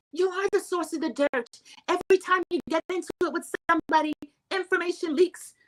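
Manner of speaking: high, fast accusing mimicry; accusing Whitney. Vlogger mimics Whitney accusing Heather, high and fast.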